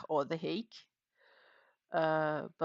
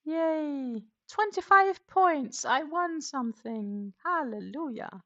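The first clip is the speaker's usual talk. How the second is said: high, drawn-out celebratory cheer; jubilant, high-pitched whoop of victory; excited cheer; 10 semitones higher, 6 dB louder, slower than usual. Real, not acted. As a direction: cheer a small win loudly, stretching it out. Celebrates winning bingo points.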